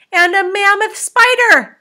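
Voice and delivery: very high, loud, slow astonishment; crying out. A narrator's reading. Captain ignoring his officer's plea, crying out. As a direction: stretch out the alarming sighting loudly.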